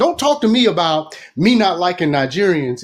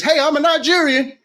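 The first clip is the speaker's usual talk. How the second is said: high, eager hopeful newcomer voice; naive claim; 10 semitones higher than usual. Retold or imitated. Telling Nigerians he's one of them, high eager voice. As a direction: play the clueless visitor announcing himself.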